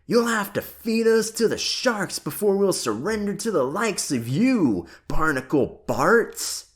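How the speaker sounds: fast, high, loud defiant cry; boy shouting defiance in a game; yelling. A narrator's reading. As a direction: hurl it at the pirate, fast. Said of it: "Ben yelled back".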